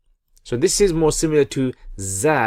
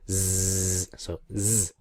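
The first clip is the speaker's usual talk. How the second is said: ordinary talk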